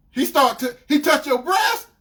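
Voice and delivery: loud, high incredulous question; outraged disbelief. Retold or imitated. Boyfriend reacting to her confession, incredulous. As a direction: stammer it back in shocked outrage.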